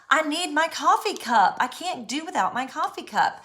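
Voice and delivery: high, loud whine about the coffee cup; frantic complaint. Retold or imitated. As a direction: act desperate over the missing cup. Acting out frantic search.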